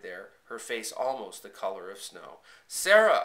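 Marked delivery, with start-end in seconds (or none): 2.7-3.2 high, loud cry of her name; piercing shout, falling at the end. A narrator's reading. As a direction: shout out to someone in danger. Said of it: "I shouted".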